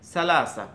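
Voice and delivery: "সালাসা" with s in place of th; Egyptian pronunciation. Shown as a demonstration. Teacher showing how Egyptians say three, s-sounding "সালাসা". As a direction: say "সালাসা" with clear s sounds, falling at the end. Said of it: "এরা বলতে, তিনকে বলতেছে".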